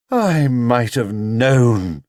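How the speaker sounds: very slow, weary sigh of a line; knowing exasperation, drawn out; resigned. Acted. Mother seeing the bear's mess, slow and resigned.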